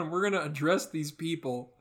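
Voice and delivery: ordinary talk, nothing marked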